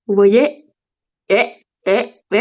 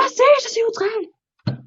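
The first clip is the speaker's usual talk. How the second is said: high mock cry; 12 semitones higher than usual. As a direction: echo the outrage in a tiny high voice. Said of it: "Arrêtez de tous crier". (petit cri aigu, faussement outré)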